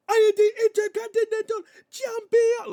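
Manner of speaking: full-volume high yell in a mock Spanish accent; explosive, high-pitched promo shout; Penta yelling. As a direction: imitate a wrestler suddenly screaming his title. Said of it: "he would just start yelling".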